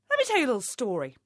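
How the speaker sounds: ordinary delivery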